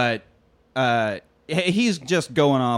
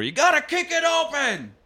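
much higher, drawn-out shouted order; mock yelling cowboy; 3 dB louder than usual. Everyone in the haberdashery yelling at the newcomer, high mock shout. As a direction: bark the door instructions. Yelled running gag about door.

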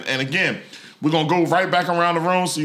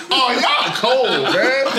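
laughing; 5 semitones higher, 3 dB louder, much slower than usual. Real, not acted. Laughing at the roast.

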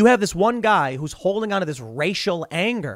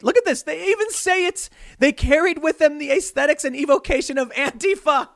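much higher, sneering parody of the article; mocking read; 11 semitones higher than usual. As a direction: read their words like a ridiculous pamphlet.

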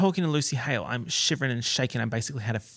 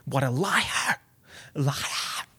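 mock phone yell without the volume; Khloe yelling impression; 4 dB quieter, much slower than usual. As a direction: imitate a drawn-out, strained "liar". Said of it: "Khloe's, like, yelling on the phone".

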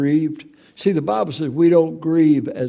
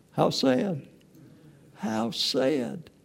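mournful lament; 6 semitones higher, 7 dB quieter, much slower than usual. Real, not acted. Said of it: "repeated lament".

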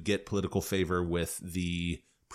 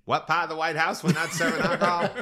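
mock guest question; 8 semitones higher, 4 dB louder than usual. Mock guest asking Lucy.

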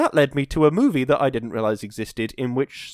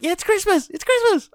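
much higher and louder mock Christmas squeal; excited squeal; 5 dB louder than usual. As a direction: shriek it like a kid on Christmas morning. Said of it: "I'm really excited about Christmas".

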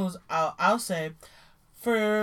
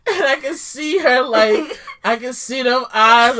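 laughing retelling; 3 semitones higher, 11 dB louder than usual. Real, not acted. Retelling friend tearing up.

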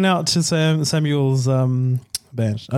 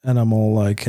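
ordinary talk, nothing marked

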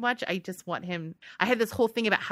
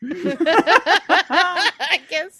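laughing through a slow pun; 9 semitones higher, 10 dB louder, much slower than usual. Real, not acted. Laughing at her own pun to her co-host.

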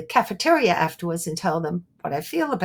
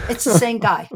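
ordinary talk

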